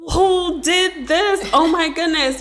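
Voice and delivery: high, drawn-out awestruck question; playfully awed, voice lifted high; retold amazement. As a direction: stretch it out, marveling at the discovery. Retold reaction on first seeing artwork.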